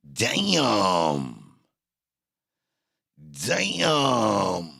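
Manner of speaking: long, falling, stretched-out DAMN; drawn-out exclamation. Pretend. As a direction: stretch each DAMN longer, sliding downward. Repeated drawn-out exclamation after rant.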